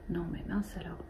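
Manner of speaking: hushed exasperated aside, barely voiced; whispered annoyance. Real, not acted. Knitter fretting at the overexposed camera, whispered annoyance. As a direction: breathe it out under your breath, irritated.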